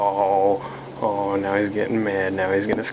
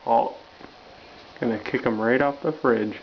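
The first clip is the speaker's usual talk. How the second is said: ordinary delivery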